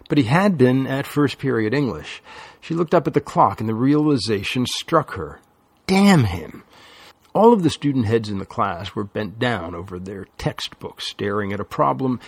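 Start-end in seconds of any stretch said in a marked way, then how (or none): 5.9-6.6 angry curse. A narrator's reading. Lily's sudden realization curse.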